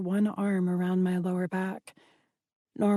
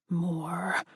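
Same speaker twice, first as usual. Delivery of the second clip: growled; 2 semitones lower, 4 dB quieter than usual. Acted. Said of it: "he growled".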